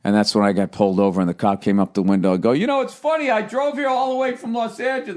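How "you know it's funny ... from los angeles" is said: high, soft put-on voice telling the cop; playing his innocent, chatty self; mock chatty self. To the cop, about driving from Los Angeles, high mock-innocent voice.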